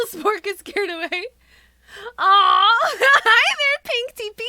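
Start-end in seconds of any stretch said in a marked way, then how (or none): 2.2-4.4 high, loud squeal with laughter; delighted greeting. Real, not acted. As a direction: squeal a laughing hello at a viewer. Delighted greeting of arriving viewer.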